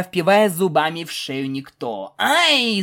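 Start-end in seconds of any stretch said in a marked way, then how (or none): 2.2-2.8 high, sharp cry; yelp of pain. Acted. Stallion bitten on the neck by pegasus, pained yelp. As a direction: cry out at a bite. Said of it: "закричал жеребец во всю глотку".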